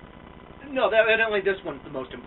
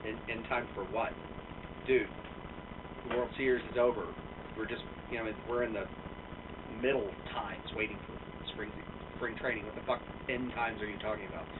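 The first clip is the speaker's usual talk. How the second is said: baffled retort; 6 semitones lower, 9 dB quieter than usual. Retold or imitated. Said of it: "And I'm like".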